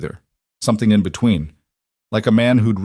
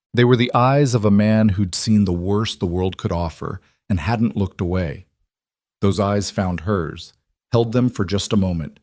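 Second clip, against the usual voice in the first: ordinary delivery, nothing marked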